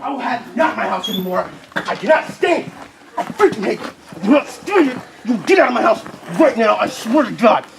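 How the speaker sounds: high, furious shouting; shaking with anger. Acted. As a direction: scream it in a rage. Furious chase of the intruder.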